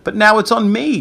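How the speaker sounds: high, whiny wail; moaning. A narrator's reading. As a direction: complain in dismay. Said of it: "Greg moaned".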